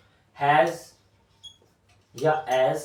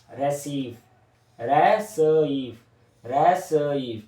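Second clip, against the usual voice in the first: 1 slow drawn-out word; 3 semitones lower, much slower than usual. Shown as a demonstration. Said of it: "teacher drawing and saying word".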